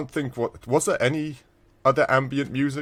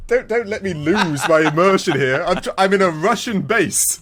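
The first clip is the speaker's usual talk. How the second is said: mock exasperated plea; 7 semitones higher, 5 dB louder than usual. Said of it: "I was like".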